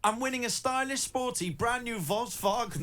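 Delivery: high, soft, hammed-up King Julian voice on Volkswagen; giggling through a King Julian accent. Shown as a demonstration. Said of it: "doing it like King Julian".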